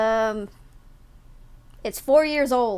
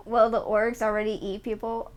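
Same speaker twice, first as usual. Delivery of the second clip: ordinary delivery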